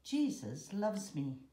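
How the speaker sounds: ordinary delivery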